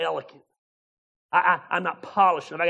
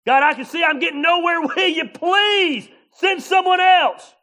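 higher, very loud, dragged-out begging shout; desperate plea; 12 semitones higher, 10 dB louder than usual. Retold or imitated. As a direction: beg God to pick anyone else.

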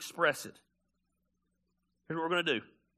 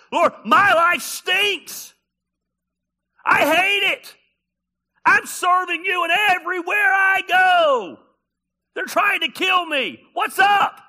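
shouted, much higher, furious complaint to God; angry ranting prayer; 12 semitones higher, 14 dB louder, slower than usual. Acted. David sounding off at God in the Psalms, furious shouting. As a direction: yell it up at heaven, fed up. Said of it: "He's just sounding off on God".

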